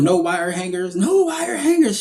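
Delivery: mock yell rising sharply on the repeat; retold yell. Classmate blurts her Mommy Dearest line, mock yelling. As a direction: imitate a yell, stretching and pitching up the second time. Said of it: "she just came out and yelled".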